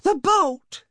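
very high, panting shout; loud strained cry. A narrator's reading. Old woman at the door calling her husband, loud cry. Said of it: "she cried loudly".

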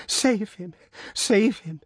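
high, desperate begging; pleading. A narrator's reading. Mother on her knees pleading with the prosecutor, high and desperate. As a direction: beg for his life, pitched high.

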